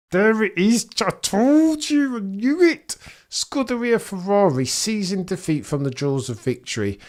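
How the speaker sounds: high, loud, strained rant; I-told-you-so yell at full tilt; exasperated outburst. Real, not acted. Exasperated outburst at Ferrari's failure.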